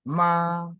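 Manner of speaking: MA held high and level; constant first tone. Shown as a demonstration. Teacher showing students the constant first tone, high level MA. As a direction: sing out MA on one high, steady, long pitch. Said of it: "C'est constant".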